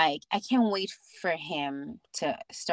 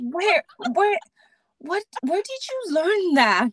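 amazed disbelief; 12 semitones higher, 5 dB louder than usual. Retold or imitated. Mother's surprise at son's accent.

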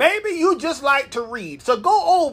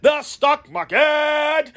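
slow, stretched The stock market, higher; call and response; 5 semitones higher, much slower than usual. Shown as a demonstration. Inviting listeners to repeat it with him, slow and stretched. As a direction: lead the crowd into repeating it. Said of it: "Can you say it with me?".

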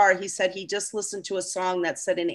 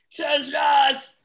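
raised, higher shout to hush them; calling out; 6 semitones higher, 3 dB louder than usual. Real, not acted. Hushing the guys before doing sounds, calling out.

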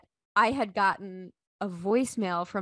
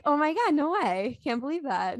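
mock disbelief; 8 semitones higher than usual. Wife humouring husband over supposed prank, in mock disbelief. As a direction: play up the disbelief, then let it drop. Acted cool reply to shocking news.

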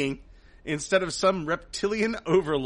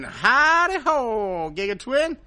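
slow, higher howdy voice; jokey cowboy greeting; 7 semitones higher, 5 dB louder, slower than usual. Acted. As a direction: drawl out a silly howdy.

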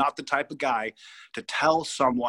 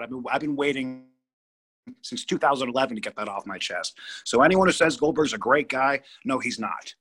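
ordinary talk, nothing marked

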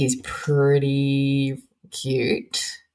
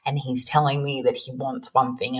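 normal talking voice

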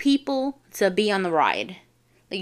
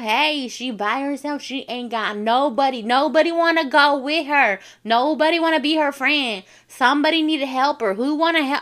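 loud, higher crowd-calling voice; loud and proud; 5 semitones higher, 4 dB louder than usual. Retold or imitated. Ride operator announcing her to the crowd, loud and proud. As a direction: call out to the whole line. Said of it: "he said it loud and proud".

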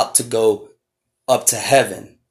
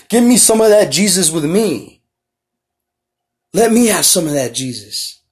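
lightly voiced Elisha begging, pitched higher; a hint of a disciple asking for more of the Spirit; pleading Elisha; 5 semitones higher than usual. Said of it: "Elisha is asking Elijah".

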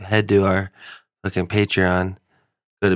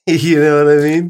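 laughing, higher and louder; 6 semitones higher, 6 dB louder than usual. Real, not acted. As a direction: say it through a laugh. Laughing after a joke.

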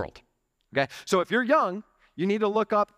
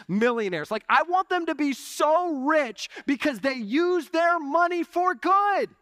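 higher-pitched, impassioned sermon delivery; fired-up preaching; 9 semitones higher than usual. Real, not acted. Pastor urging his church to be generous, in fired-up preaching. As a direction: preach it with rising fervor.

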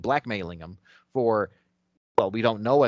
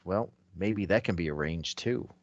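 low grim mutter; 5 semitones lower, 7 dB quieter than usual. Acted. Voicing hero's grim thought.

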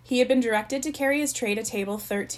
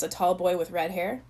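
ordinary delivery

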